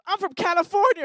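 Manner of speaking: high, loud imitation of a proud guest; imitated boastful guy. Host retelling last year's guest, imitated loud announcement. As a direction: call it out like an overeager contestant. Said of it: "And this guy goes".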